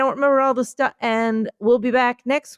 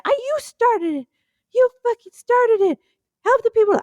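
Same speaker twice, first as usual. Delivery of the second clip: higher squeaky shoe snapping back; the first shoe fights for its turn; first shoe, bickering; 12 semitones higher than usual. Pretend. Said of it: "I'm a shoe".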